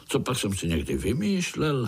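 ordinary delivery, nothing marked

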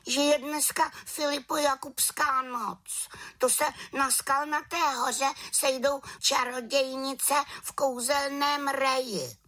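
very high witch voice; cross little witch. A narrator's reading. Little witch explains about Walpurgis Night, high complaining voice. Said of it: "řekla malá čarodějnice".

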